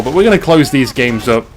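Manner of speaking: normal talking voice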